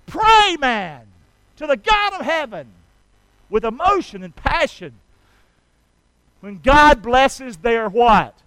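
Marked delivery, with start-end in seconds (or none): 0.0-1.1 preaching shout. Real, not acted. Preacher exhorting. 6.4-8.3 preaching call. Real, not acted. Preacher calling to crowd.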